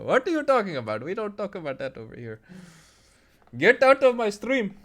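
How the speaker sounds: high, hammed-up Indian accent; mock-indignant outburst in an Indian accent. Shown as a demonstration. Streamer scolding viewers on his stream, hammed-up Indian accent. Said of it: "That Indian accent, though".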